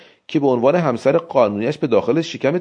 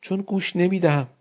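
higher mock-petulant outburst; sulky wife snapping, higher; wife cuts off the narrator, higher petulant cry; peevish outcry; 5 semitones higher than usual. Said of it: "ناگهان بدخلق شد و فریاد زد".

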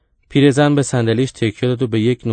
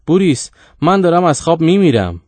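loud, high complaint through a wall; complaining call; 6 semitones higher, 6 dB louder than usual. A narrator's reading. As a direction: call out in protest to the next room. Sleepy uncle complains through thin walls. (غرغر بلند از پشت دیوار)

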